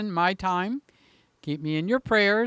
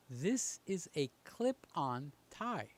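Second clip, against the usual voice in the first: slow and much softer, leaning in with "This"; hushed reveal; 5 semitones lower, 13 dB quieter, slower than usual. Real, not acted. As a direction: draw them close as if sharing a secret. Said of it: "listen carefully".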